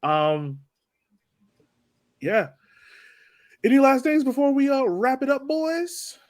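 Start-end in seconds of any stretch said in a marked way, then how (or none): none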